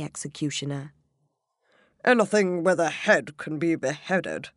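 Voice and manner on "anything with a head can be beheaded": king arguing. Acted. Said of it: "the king argued".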